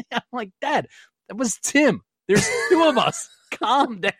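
high, laughing retelling. Real, not acted. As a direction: laugh through the words.

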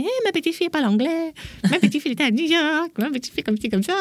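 high-pitched grandmother bragging to the neighbourhood; mock boasting in a high voice. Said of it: "qui racontait dans tout le quartier".

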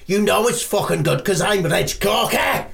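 puffed-up diva outburst; indignant boast. Acted. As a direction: proclaim your own name in outrage. Diva bristles at being questioned.

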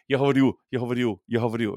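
put-on fast rattled-off "Я говорю" in a rural Russian accent; rural Russian filler. Villager mimicking how country folk pad every sentence, rural Russian accent. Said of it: "word in the sentence is".

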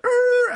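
high-pitched vocal skid noise; imitated tyre screech. Van stopping abruptly, imitated screech. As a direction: mimic brakes squealing to a halt.

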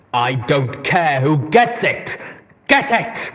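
loud, slow bellowing roar; bellowed. A narrator's reading. Deus bellowing at both of them. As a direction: bellow the order. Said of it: "he bellowed".

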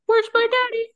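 high, loud little fish call; Nemo fish voice. Acted. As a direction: plead like a lost baby fish.

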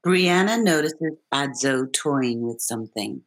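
measured read of stage directions; calm stage-direction read; script stage directions. Acted. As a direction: set the scene like a script reader.

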